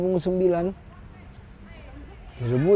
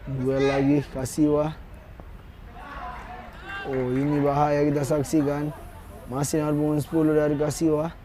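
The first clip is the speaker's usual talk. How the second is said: normal talking voice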